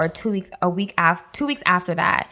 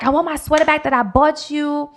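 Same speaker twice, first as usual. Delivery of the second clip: hurt demand; 7 semitones higher than usual. Retold or imitated. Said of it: "but I was so hurt".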